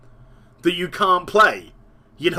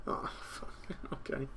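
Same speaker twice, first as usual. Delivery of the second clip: muttered aside; 2 semitones lower, 20 dB quieter than usual. Real, not acted. Whispered aside after joke.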